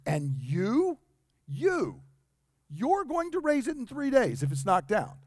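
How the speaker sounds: repeated "you, you" rising to a high incredulous "you're"; emphatic you. Shown as a demonstration. Leaders scoffing at Jesus, emphatic repeated you. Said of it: "There is a huge emphasis on not you".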